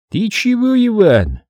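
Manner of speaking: a touch of fake surprise; hypocritical surprise. A narrator's reading. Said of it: "Лицемерно удивился Сулейман". (лицемерное удивление)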